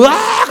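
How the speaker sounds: high mock shriek with a laugh; scared scream. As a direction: shriek and run from pigeons. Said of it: "하면 도망갈걸요?".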